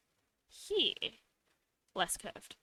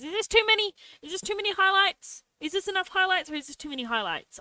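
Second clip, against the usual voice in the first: much higher and louder, asking eagerly; excited squeal; 11 semitones higher, 7 dB louder than usual. Real, not acted. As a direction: bounce the question at the chat. Excited asking chat about highlights.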